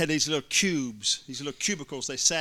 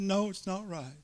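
lightly voiced child, higher and softer; a touch of a sad little girl; 6 semitones higher, 6 dB quieter than usual.

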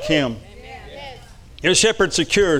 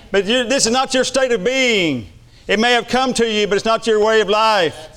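preaching; 4 semitones higher than usual. Acted. Preaching at rising intensity.

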